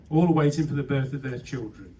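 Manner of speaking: normal talking voice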